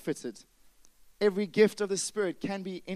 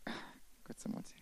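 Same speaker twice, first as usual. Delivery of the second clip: whispered aside; 16 dB quieter, faster than usual. Real, not acted. Sighs, overwhelmed, whispered aside.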